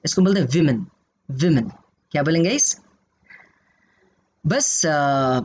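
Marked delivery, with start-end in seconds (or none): 0.5-1.7 विमेन with first vowel as in "win"; विमेन said clearly as the plural form; clear pronunciation. Shown as a demonstration. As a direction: stress the wi of विमेन. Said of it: "इसका pronunciation बदल जाता है".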